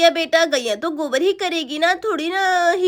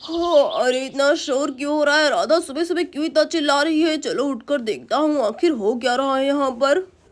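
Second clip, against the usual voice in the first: puzzled father. Acted. Voices the father.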